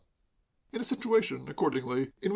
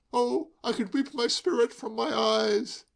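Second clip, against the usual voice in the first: high, slow hammed-up grief; milk the tragic line; mock tragic lament; 9 semitones higher, slower than usual. A narrator's reading.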